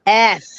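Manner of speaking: belching out the letter; burped letter. Real, not acted. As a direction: burp the letter. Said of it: "I'm burping the alphabet by request".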